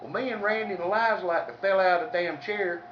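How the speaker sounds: ordinary talk, nothing marked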